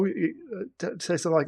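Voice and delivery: usual speaking voice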